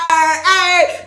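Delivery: loud high "Hey!"; excited shout. Acted. Finishing the childhood rhyme, excited shout. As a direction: cap the chant with a shout. End of chant.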